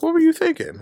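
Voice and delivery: high scolding nurse voice, imitated; hammed-up disbelieving nurse; mock nurse scolding. Nurse scolding, retold in story.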